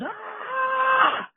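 long, very high held scream; high mock shriek; imitated scream. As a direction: mimic a sustained high-pitched scream, held back in volume. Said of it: "just screams".

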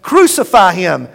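loud shouted demand; shouting crowd. Acted. Priests and guards demanding death, loud shout. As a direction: hurl it like a mob. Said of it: "began shouting".

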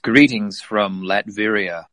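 slow, commanding villain greeting; Doom greeting voice. Acted. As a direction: pronounce it like a ruler. Podcast opening in Doom voice.

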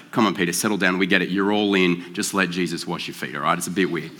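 soft, low "settle down"; calming Peter down. Retold or imitated. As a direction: hush an overeager friend kindly. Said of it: "it's like".

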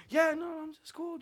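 high-pitched mock casual reassurance; feigned nonchalance. Hiding ghost fears from girlfriend on phone, feigned nonchalance. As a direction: play it cool, covering up the fear. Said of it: "trying to hide it".

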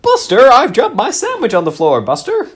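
very high, loud, fretful mock voice; silly character voice. Playing along, begging Buster in a very high loud squawk. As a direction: squawk it in a panic. Improv game.

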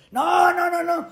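sharp high no, no, retold with a near-shout; insistent refusal. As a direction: push back hard, no way. Retold refusal to join show. (rechazo insistente casi gritado)

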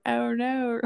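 high, exaggerated Australian "Oh no"; Australian accent. Shown as a demonstration. Showing what R and R sounds like, in an exaggerated Australian accent. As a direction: stretch it in a mock Australian accent. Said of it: "you're saying the Australian accent of".

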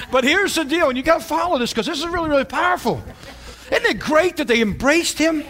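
rising sermon delivery; preaching. Acted. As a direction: drive the story home to the church. Preaching again.

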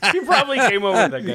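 high, loud, through real laughter; laughing. Real, not acted. Host riffs on the punchline to friends, laughing hard. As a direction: crack up while getting the words out.

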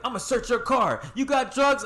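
high-pitched questioning cop voice; imitated cop. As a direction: play the officer, pitched well up. Acts out cop questioning him.

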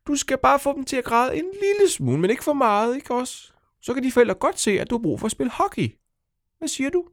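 high, squeaky coaxing creature voice; sly little creature. Acted. The little poops talk Nora into her plan, squeaky and coaxing. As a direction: wheedle her like a tiny tempter.

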